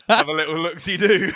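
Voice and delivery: high, loud, jokey cartoon-neighbour voice; Ned Flanders. Teasing the co-host's odd word, hammed-up cartoon-neighbour impression. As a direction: chirp it like a folksy cartoon neighbour, amused. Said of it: "That just sounds like Ned Flanders".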